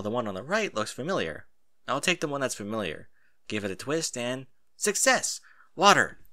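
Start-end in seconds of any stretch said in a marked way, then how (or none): 4.8-6.2 loud, delighted cry of relief; triumphant little yell at the tap; excited. Acted. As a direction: shout it out, thrilled to find it. Finding water in shower.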